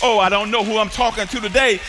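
usual speaking voice

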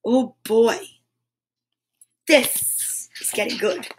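usual speaking voice

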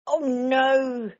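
loud, high exasperated groan; Mister Bear at wit's end; fed up. A narrator's reading. Mister Bear kept awake by Baby Bear, groaning loudly. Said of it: "said Mister Bear".

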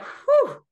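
big, high, loud sigh of relief; loud relieved exhale; relieved whew. Retold or imitated. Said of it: "you get to go like".

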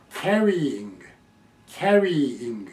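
キャリーイング with long "carry", then separate "ing"; full stem plus "ing". Shown as a demonstration. Teacher modelling for learners, キャリーイング with the stem intact. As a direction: hold the "ee" before "ing" in キャリーイング. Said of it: "こっちははっきりそのまま発音しなきゃ".

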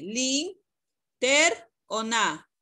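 "Lin, ter o na" slowly, each syllable apart; syllables offered separately. Shown as a demonstration. Teacher asking Astrid which syllable is stronger, "Lin, ter o na" spaced out. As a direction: pause between the choices "Lin", "ter", "na". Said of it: "¿Cuál de las tres sílabas suena más fuerte?".

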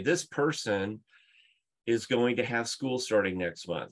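normal talking voice

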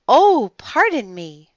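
high, loud, startled politeness; embarrassed, lifted exclamation; flustered apology. A narrator's reading. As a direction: apologise as if caught off guard. Flustered woman apologises, blushing.

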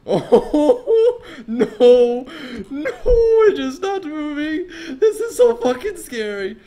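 high loud whimpering between laughs; half-laugh, half-sob in real fright; panicked laughing cry. Real, not acted. Streamer laugh-crying at the haunted chair, high and loud.

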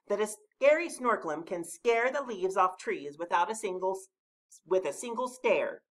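high animal character voice; Badger's voice. A narrator's reading. Badger setting the Snorklum a test, high voice. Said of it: "said Badger".

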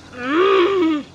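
sudden squeaky hiccup; a real hiccup breaks out. Real, not acted. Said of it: "I have the worst hiccups today".

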